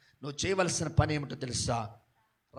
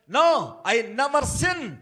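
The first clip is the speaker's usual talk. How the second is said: slow, emphatic denial as Joseph; firm refusal; 11 semitones higher, 13 dB louder, much slower than usual. Acted. Joseph turning away sin, firm and slow. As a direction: refuse with each word weighed.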